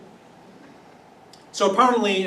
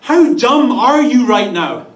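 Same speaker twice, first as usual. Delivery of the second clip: louder, much higher, laughing disbelief; hammed-up angelic exasperation; exasperated scolding; 4 semitones higher, 7 dB louder than usual. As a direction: stammer in mock outrage at her. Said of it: "they're basically saying".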